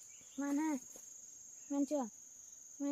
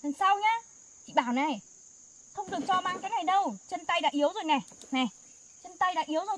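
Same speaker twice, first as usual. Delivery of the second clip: loud, high-pitched telling off; scolding; 3 semitones higher, 8 dB louder than usual. Real, not acted. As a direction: scold them firmly and loudly. Scolding sibling for heavy load.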